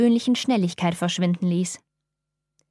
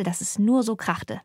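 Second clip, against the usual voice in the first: plain reading voice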